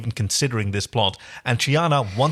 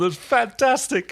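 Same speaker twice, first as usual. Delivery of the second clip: gushing delight; 10 semitones higher, slower than usual. Real, not acted. Delighted gushing about dancing.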